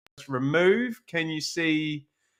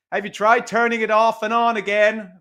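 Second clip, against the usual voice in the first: a slightly higher Irish accent, a quick passing impression; Chris O'Dowd Irish accent; 5 semitones higher, 3 dB louder than usual. Streamer quoting the IT Crowd to viewers in an Irish accent. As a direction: throw in a quick, light Irish accent for the joke. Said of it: "Apologies to any Irish, um, listeners there for that accent".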